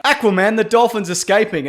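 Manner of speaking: panicked French cop. Retold or imitated. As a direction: yell it like a panicking policeman. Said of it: "the guy's like".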